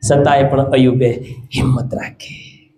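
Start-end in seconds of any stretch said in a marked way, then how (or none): 1.5-2.7 slow, low whisper; soft, drawn-out preacher's whisper; hushed emphasis. Real, not acted. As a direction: drop to a slow hush for the key words. Hushed key line after loud preaching.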